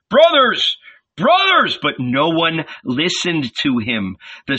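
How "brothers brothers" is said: high, loud appeal; urgent high-pitched call; shouting. A narrator's reading. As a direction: shout to stop a crowd. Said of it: "He spread his arms and shouted".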